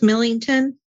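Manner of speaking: ordinary delivery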